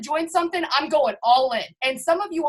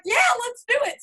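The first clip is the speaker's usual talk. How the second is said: eager yes; 9 semitones higher than usual. Retold or imitated. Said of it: "I just was like".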